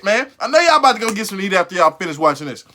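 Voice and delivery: ordinary delivery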